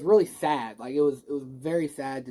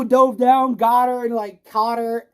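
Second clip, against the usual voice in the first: much higher and louder, thrilled; excited retelling; 7 semitones higher, 9 dB louder than usual. Real, not acted. Fan reliving Andrew's Peter saving MJ, excited and loud. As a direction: gush over the rescue.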